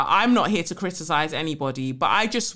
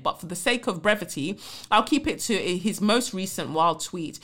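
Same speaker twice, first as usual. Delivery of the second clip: normal talking voice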